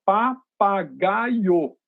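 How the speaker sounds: "papagaio" slowly in four separate beats, pa-pa-gai-o; very slow, spaced-out "papagaio", each syllable its own beat; split into syllables. Shown as a demonstration. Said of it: "E quatro sílabas?".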